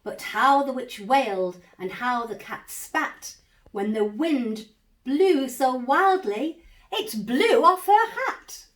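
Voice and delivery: ordinary delivery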